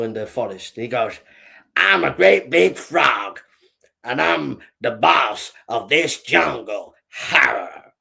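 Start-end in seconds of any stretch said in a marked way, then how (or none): 1.8-7.9 slow, boastful frog boss; bullying big frog. Acted. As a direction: drag out each word as a cocky bully. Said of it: "He was a bit of a bully".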